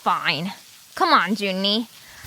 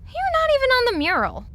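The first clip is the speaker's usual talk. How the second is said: mocking jab; 13 semitones higher than usual. Mocking the monkey.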